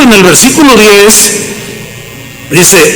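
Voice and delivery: usual speaking voice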